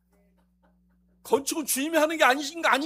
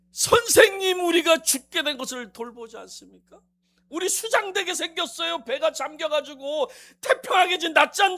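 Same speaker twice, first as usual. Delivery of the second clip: pleading; 5 semitones higher, 8 dB louder than usual. Acted. Disciples cry out.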